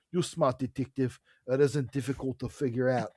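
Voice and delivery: put-on dodgy South African accent for a caller. Mystery caller taunting the detective, in a bad South African accent. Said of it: "probably a terrible South African accent".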